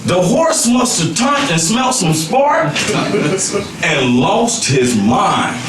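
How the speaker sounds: usual speaking voice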